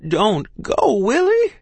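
high, loud desperate plea; crying out. A narrator's reading. Mother begs child to stay, crying out. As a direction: call out high and pleading. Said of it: "she cried".

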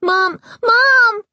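high, loud panicked crying; calling for mom. A narrator's reading. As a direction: cry out for mom in terror. Terrified boy calling for help.

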